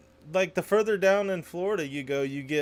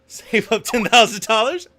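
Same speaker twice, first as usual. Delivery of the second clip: laughing; 7 semitones higher, 6 dB louder than usual. Real, not acted. Joking about moving to Latvia.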